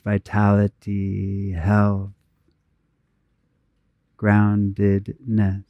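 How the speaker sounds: unhurried, even meditation voice with long pauses; guided meditation. Real, not acted. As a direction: guide them gently inward, phrase by phrase, leaving space to breathe.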